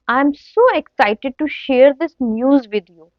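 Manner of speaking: ordinary talk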